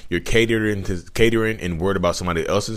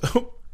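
short laugh; 4 dB quieter than usual. Real, not acted. Laughs at own tongue slip.

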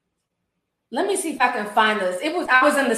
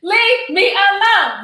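drawn-out, high mocking leave me alone; mock sing-song; 6 semitones higher, 4 dB louder, much slower than usual. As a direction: stretch it out like a taunting chant. Mocking the clip, sing-song repeat.

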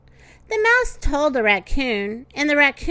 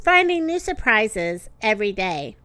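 ordinary delivery

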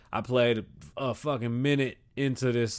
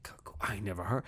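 soft confused whisper; 6 semitones lower, 11 dB quieter, faster than usual. Real, not acted. Vlogger whispering he never heard the word. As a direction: say it under your breath.

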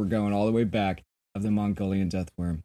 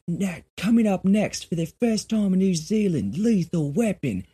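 higher mock New Zealand TV announcer; 8 semitones higher, 3 dB louder than usual. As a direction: announce it like a small-town TV ticker. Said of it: "Along the Bottom Ticker is like".

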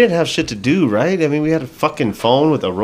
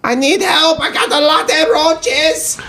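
much higher, put-on silly caller voice; prank caller voice; much slower than usual. Prank calling his mom in a high put-on caller voice. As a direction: fake a goofy stranger on the phone. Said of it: "making up shit".

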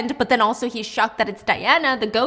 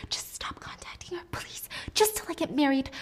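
desperate whisper; 5 semitones higher, 6 dB quieter than usual. Acted. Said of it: "Mina gets up crying".

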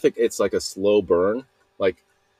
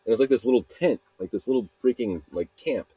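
normal talking voice

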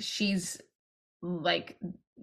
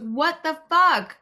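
outraged disbelief; 8 semitones higher, 6 dB louder, slower than usual. Retold or imitated. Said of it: "I was like".

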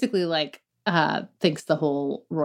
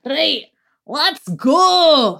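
loud, raised Scottish call; Scottish husband; 9 semitones higher, 7 dB louder, much slower than usual. Acted. Wife doing another line of her husband, loud Scottish accent. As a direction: shout it like a Scotsman rallying someone. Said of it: "Is that really what he sounds like?".

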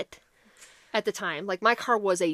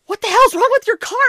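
much higher, louder alarmed yell; alarmed shout; 13 semitones higher, 13 dB louder than usual. Retold or imitated. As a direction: shout it out in disbelief. Retold alarmed question about the car.

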